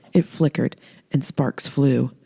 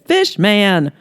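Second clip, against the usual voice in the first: louder, urgent shout of the warning; urgent blurt; 5 semitones higher, 5 dB louder than usual. Acted. Earl warning Holly about monsters, urgent shout. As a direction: blurt it out in alarm.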